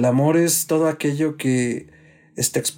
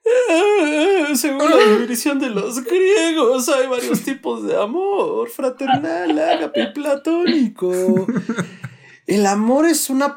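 high put-on voice; 13 semitones higher, 3 dB louder, slower than usual.

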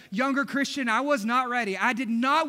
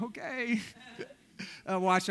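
laughing groan; 5 dB quieter than usual. Retold or imitated. Husband made to watch birth.

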